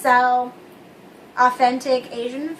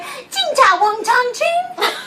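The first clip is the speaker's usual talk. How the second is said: mock gibberish; 8 semitones higher, much slower than usual. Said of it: "and she was like".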